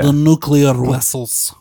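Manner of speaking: put-on slow, comic Russian accent; slow Russian-accented character voice; Chekhov. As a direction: draw it out in a mock Russian accent. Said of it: "Is that Chekhov?".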